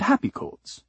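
high, lilting end to a list; happy lilt. A narrator's reading. Narrator listing kinds of chords, happy lilt. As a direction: lift the pitch to suit the happy words.

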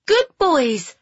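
put-on high girlish call to dogs; shouted. A narrator's reading. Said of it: "Phoebe shouted".

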